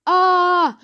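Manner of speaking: high, loud "aah"; sudden storybook scream; cry of shock. A narrator's reading. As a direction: yelp out a drawn-out ah. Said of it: "cried Missus G".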